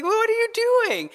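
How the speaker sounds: put-on high, quick child's protest; imitated child's indignant question. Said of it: "the child's like".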